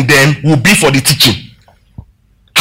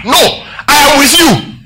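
higher and slow, every word leaned on; emphatic correction; 7 semitones higher, slower than usual. Acted. Preacher answering the false goodbye, high and slow. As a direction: set them straight, slowly.

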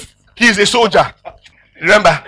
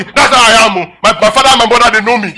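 loud, raised-pitch mock bluster; angry; 4 semitones higher, 6 dB louder than usual. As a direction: act out an indignant, defiant boast. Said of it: "When you're angry".

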